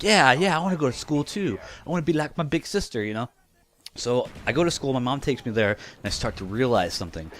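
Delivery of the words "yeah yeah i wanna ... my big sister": put-on eager little kid, higher and fast; mock childish eagerness. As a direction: gush like an excited five-year-old. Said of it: "and I'm like".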